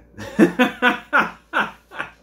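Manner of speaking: long, loud, high-pitched laugh. Real, not acted. Storyteller amused by his childish reply, laughing loudly. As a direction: burst out laughing for a couple of seconds.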